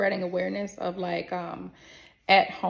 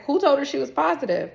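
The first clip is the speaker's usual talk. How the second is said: higher, louder, baffled demand; imitated incredulity; 6 semitones higher, 5 dB louder than usual. Supervisor questioning the nurse over the test, imitated incredulity. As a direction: challenge it as the disbelieving supervisor.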